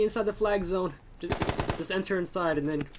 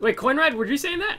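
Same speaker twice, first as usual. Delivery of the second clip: surprised call; 8 semitones higher, 3 dB louder than usual. Real, not acted. Urgent call to teammate.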